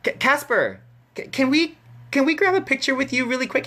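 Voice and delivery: high, stammering imitated ask; timid fan request. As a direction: stutter into a squeaky, hurried request. Acted timid request to a celebrity.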